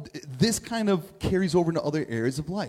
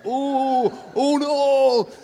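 higher, hammed-up fake fright; mock scared; 9 semitones higher, 8 dB louder, much slower than usual. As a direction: pretend to be scared so it ends sooner. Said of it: "I'm supposed to act scared".

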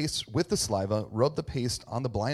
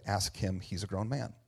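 quiet deflection; 4 semitones lower, 7 dB quieter than usual. Retold or imitated. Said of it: "his parents said".

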